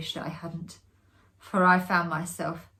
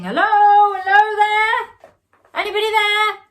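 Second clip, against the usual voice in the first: loud, high, drawn-out call; shouting; 12 dB louder than usual. A narrator's reading. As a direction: call out across the landing. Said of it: "young Reggie's voice shouting".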